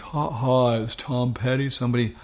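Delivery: put-on puzzled caller with a Gainesville accent; acted Gainesville accent on the phone; [name]. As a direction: imitate a musician calling back in a Gainesville accent. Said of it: "best I can do is my Gainesville accent".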